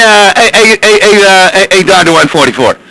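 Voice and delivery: normal talking voice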